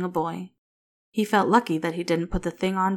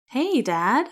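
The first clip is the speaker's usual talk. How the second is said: bright, higher cheerful greeting; 6 semitones higher, 4 dB louder than usual. A narrator's reading. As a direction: greet him cheerfully, pitched up. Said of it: "a nurse greets cheerfully".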